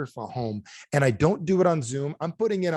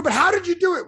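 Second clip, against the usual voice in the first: high, loud, insistent wonder; excited disbelief; 6 dB louder than usual. Acted. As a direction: press for the secret, astonished. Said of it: "Now he's excited".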